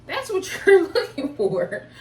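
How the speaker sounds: loud, high, laughing exclamation; incredulous laugh pushing the voice up. Real, not acted. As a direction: break into a real laugh mid-sentence. Laughs mid-sentence at own point.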